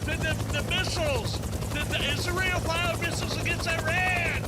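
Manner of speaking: high loud shouted question; mock reporter yelling. Pretend reporter yelling at Trump over rotors, high and loud. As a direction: yell the question over a helicopter.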